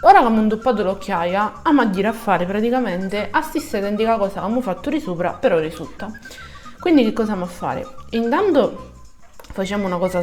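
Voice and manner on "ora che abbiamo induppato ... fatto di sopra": normal talking voice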